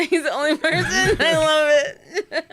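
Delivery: high, slow words through helpless laughter; real laughter breaking through the words; laughing. Real, not acted. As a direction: crack up while you say it. Laughing hard at the story.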